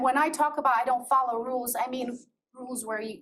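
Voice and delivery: ordinary delivery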